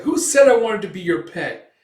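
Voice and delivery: high, very loud protest; indignant retort. Retold or imitated. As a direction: snap back, deeply offended. Sidekick protests being called a pet.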